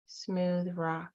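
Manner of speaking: calm, even meditation voice with long pauses; guided meditation. Acted. Guide leading listeners back to their inner child, calm meditation voice. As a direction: guide them gently, leaving room to breathe between phrases.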